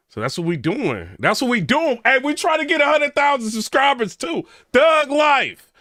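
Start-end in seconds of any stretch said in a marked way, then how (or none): 1.2-2.0 loud, high excited repeat; excited call to the fans; hyped up. Real, not acted. 2.0-5.7 high, loud rallying cry; excited, building to a yelled slogan; hyped shout. Real, not acted. Rallying viewers for subscribers.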